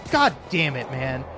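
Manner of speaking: ordinary talk, nothing marked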